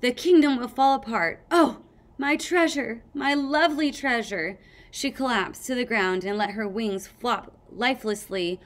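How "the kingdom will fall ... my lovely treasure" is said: loud, higher mock lament; overacted queen's despair; hammed-up dying queen. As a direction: wail theatrically over the loss. Sunny's queen dying theatrically.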